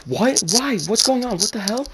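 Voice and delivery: high-pitched, rattled questions; mock a mother's rising alarm; imitated alarmed mom. Mom learns he's pulled over, imitated alarm.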